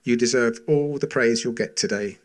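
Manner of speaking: ordinary talk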